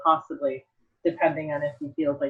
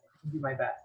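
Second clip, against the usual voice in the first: "I can do my best" said again much softer; softer echo to herself; quiet repeat; 3 semitones lower, 12 dB quieter than usual. Shown as a demonstration. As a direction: drop "I can do my best" to a murmur.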